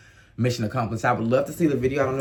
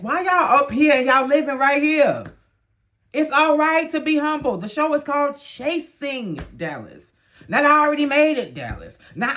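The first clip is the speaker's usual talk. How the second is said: much higher, loud exasperated lecture; 12 semitones higher, 4 dB louder than usual. Real, not acted. Host scolding the cast for moving too fast, exasperated and high. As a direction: rein them in like a fed-up big sister.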